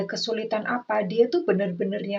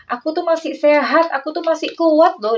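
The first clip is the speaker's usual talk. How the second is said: higher and louder, insisting he's still strong; insistent old partner; 8 semitones higher, 7 dB louder than usual. Retold or imitated. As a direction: protest that you're still fit, voice raised. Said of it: "dia tuh ngomong gitu sama kamu". (meyakinkan pasangan bahwa masih kuat, suara lebih tinggi dan keras)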